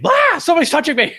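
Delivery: high, loud startled yelp; playacted cry of alarm; mock panic. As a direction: shriek it as a hammed-up fright. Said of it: "I just like when he's like".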